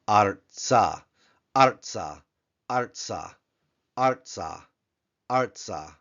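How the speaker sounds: ARTsa, stress on the first syllable; teacher modelling Artsa; Hebrew word Artsa. Shown as a demonstration. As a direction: say Artsa slowly and clearly. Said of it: "If you wanna bring out the Patak".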